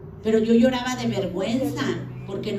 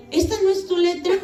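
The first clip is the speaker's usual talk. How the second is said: high, louder incredulous question; retold astonishment; 10 semitones higher, 5 dB louder than usual. Husband questioning whose handwriting it is, mock astonished. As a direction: imitate shock with pitch shooting up. Husband shocked about the letters.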